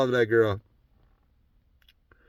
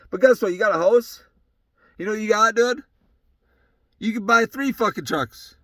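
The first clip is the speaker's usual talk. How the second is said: usual speaking voice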